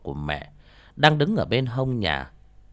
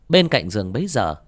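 usual speaking voice

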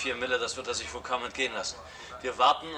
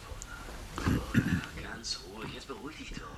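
whisper. Acted. Man secretly calms Paula on the phone, whispering. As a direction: hush her gently in a whisper.

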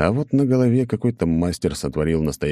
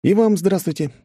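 cheeky greeting; 14 semitones higher, 4 dB louder than usual. Acted.

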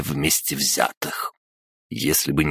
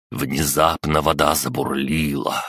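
ordinary delivery, nothing marked

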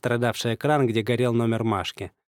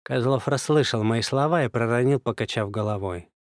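usual speaking voice

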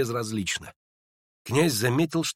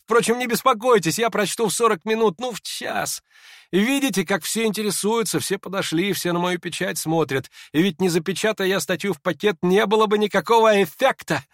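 high, agitated, mocking chatter; feverishly excited; 8 semitones higher, 4 dB louder than usual. A narrator's reading. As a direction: talk on excitedly in a raised pitch. Hippolyte keeps up hysterical speech.

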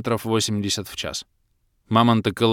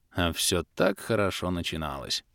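ordinary delivery

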